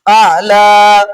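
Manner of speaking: आला loud, with the last "aa" held long; drawn-out, ringing final vowel on आला; long standing vowel. Shown as a demonstration. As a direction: recite आला slowly, stretching "laa". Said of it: "हालत वक्त में उसको ऐसे ही पढ़ेंगे".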